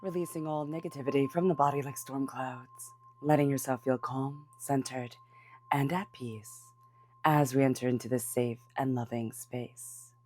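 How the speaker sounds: soft, hushed meditation voice; guided meditation. Acted. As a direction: guide them gently into calm. Leads a cleansing meditation.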